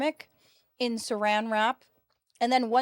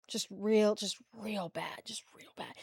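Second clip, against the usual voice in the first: whisper; 2 semitones lower, 10 dB quieter, slower than usual. Real, not acted. Mocking sister's singing.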